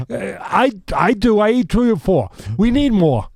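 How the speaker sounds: imitated food squabble; insistent arguing. Cus insisting in retold argument.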